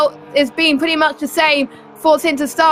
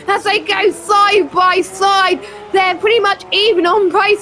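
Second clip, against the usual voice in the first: higher, building race call; excited commentary; 4 semitones higher than usual. Real, not acted. Commentator calling the lead change, raised and excited. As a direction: ride the thrill of the overtake.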